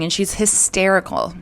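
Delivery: usual speaking voice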